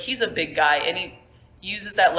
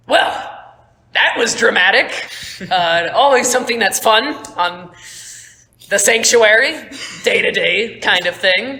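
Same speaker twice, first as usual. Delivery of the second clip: louder, higher airy captain, laughing; breezy pirate captain; 3 semitones higher, 5 dB louder than usual. Acted. Captain Joe addressing the crew after the fight, breezy and amused. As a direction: toss off orders like it's nothing. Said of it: "Captain Joe goes".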